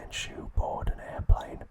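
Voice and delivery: ordinary delivery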